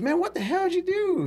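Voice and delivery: high-pitched, incredulous retold question; mock-astonished and high; retold disbelief. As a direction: act out someone's startled reaction. Retold startled reaction to a mishap.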